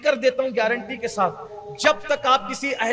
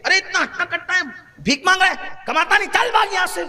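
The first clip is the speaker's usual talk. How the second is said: higher and sharper, mock-harsh brush-off; scornful scolding; 6 semitones higher than usual. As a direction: shoo the beggar away in contempt. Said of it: "کہتے ہیں". (حقارت سے ڈانٹتے ہوئے)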